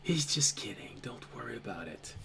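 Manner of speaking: very soft, low whisper; hushed and calming, barely audible; whispered reassurance. Acted. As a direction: soothe them under your breath. Sashay quietly reassures at night.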